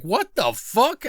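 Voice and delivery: high, slow, stunned "what the fuck"; shocked disbelief. Retold or imitated. Partygoers gasping as the rich man burns the painting, stunned. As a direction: let the disbelief stretch each word out. Everyone reacts to burned painting.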